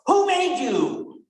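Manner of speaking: mock old priest's drawn-out question; stern Monsignor. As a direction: boom it out like an old priest quizzing a scared class. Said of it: "the old Monsignor would come ... and would go".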